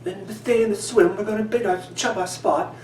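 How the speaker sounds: high-pitched mock English accent. Angler jokingly says it as they would in England, hammed-up English accent. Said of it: "English accent up".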